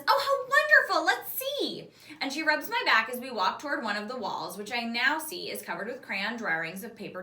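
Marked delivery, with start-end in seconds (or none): 0.1-1.9 delighted mom. A narrator's reading. Mom's delighted reply voiced.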